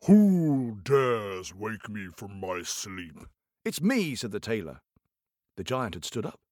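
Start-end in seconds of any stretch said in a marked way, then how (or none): 0.1-3.3 low, slow booming giant. Acted. Giant woken from sleep, slow deep rumble. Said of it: "giant voice in story". 3.6-4.1 lightly higher little tailor. Acted. Tailor answering the giant, lightly higher.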